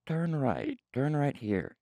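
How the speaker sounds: lightly put-on phone navigation voice; robot lady voice. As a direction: do a little robot direction-giver. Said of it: "[name]'s fucking robot voice".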